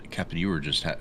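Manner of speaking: ordinary delivery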